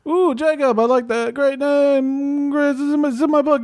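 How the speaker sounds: high-pitched, showy approval; approving comic Jesus. Acted. Jesus plugs his book to visitor, high-pitched and approving.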